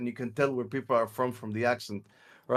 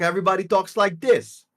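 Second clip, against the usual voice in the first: louder, higher New York accent; 5 semitones higher, 6 dB louder than usual. Shown as a demonstration. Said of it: "if you go to New York".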